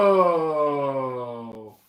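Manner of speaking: long drawn-out whoa; amazed. Real, not acted. Host pulling out a big mystery item, amazed. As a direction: let the wonder stretch out slowly. Amazed reveal of box contents.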